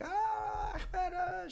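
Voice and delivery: high exasperated mother's cry; a hint of a frazzled mother scolding; mock mother calling her son's name; mother yelling back. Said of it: "she'd be, like, yelling at him".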